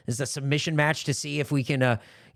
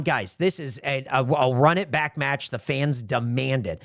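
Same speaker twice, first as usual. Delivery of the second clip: ordinary talk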